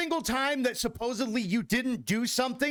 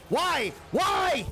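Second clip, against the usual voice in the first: rising shout; 9 semitones higher than usual. Real, not acted. Repeated why rising to shout.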